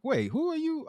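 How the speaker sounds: sudden high puzzled question; confused surprise. Retold or imitated. Varnell confused at the door, high surprised question. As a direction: jump up in pitch, thrown.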